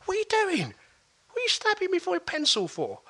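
high, indignant demand; indignant challenge. Retold or imitated. Eight-year-old confronting the pencil stabber, high and indignant. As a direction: turn round and confront him, voice up high.

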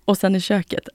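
normal talking voice

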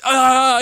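high-pitched panicked "aah", imitated; high mock wail. Lad lost for how to continue, mock wailing. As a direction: wail helplessly as if stuck mid-scene. Lad stuck in improvised scene.

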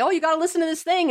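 high, fast, breathless imitation of an overeager listener; mock excited gush. Imitating a listener rushing to tell their partner, fast excited gush. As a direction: gush it out quickly like you can't wait to share.

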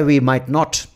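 usual speaking voice

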